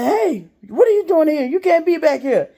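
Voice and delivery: acted-out voice catching an intruder; alarmed challenge. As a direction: call out a high, startled telling-off. Retelling staff challenging her intrusion.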